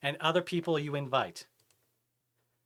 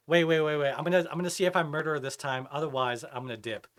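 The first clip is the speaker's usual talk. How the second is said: usual speaking voice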